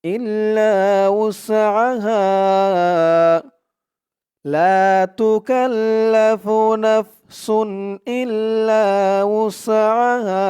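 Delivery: Quran recitation. Shown as a demonstration. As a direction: recite the whole phrase in one flowing tune. Recites the full phrase. (উঁচু সুরে ধীর তিলাওয়াত)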